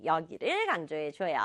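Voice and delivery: ordinary delivery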